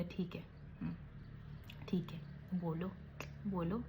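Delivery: imitating a cowed husband, barely whispered; meek whisper. Imitating husband Vicky giving in to his wife, meek whisper. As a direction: breathe it out like a man giving in. Husband forced to agree meekly.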